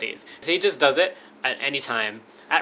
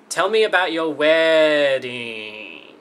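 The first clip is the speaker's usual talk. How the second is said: slow, louder, exaggerated read of the question; drawn-out reading; 6 dB louder, much slower than usual. Shown as a demonstration. Reading out a friend's oddly written question, slow and drawn out. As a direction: stretch every word as if mocking the wording. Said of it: "that's really how she wrote it".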